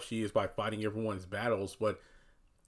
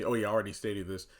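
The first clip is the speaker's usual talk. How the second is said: usual speaking voice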